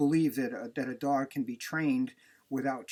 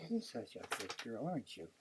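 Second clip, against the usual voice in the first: cooing to dog; 3 semitones lower, 11 dB quieter than usual. Real, not acted.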